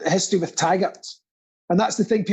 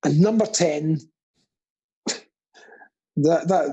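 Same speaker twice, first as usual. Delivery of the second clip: ordinary talk, nothing marked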